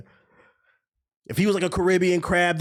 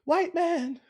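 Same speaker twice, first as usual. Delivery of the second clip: mock mermaid scream; 10 semitones higher, slower than usual. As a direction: imitate a damsel calling out in a high voice. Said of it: "Fucking Little Mermaid screaming".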